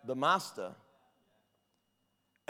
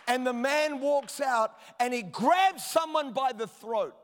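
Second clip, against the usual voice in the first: raised, emphatic sermon delivery; full-force preaching; 9 semitones higher, 9 dB louder than usual. Real, not acted. Preacher urging church to praise a forgiving God, impassioned. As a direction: drive it home to the whole congregation.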